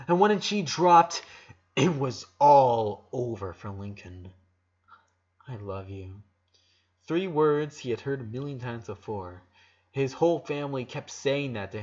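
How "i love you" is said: softly, low, almost under the breath; hushed confession. Acted. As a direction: let the words barely come out, tender and low. Girl's hushed love confession.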